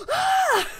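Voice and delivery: high loud mock shriek; startled scream. Neighbor startled on dark basement stairs, high loud shriek. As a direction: shriek like you've been ambushed on the stairs. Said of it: "she screams".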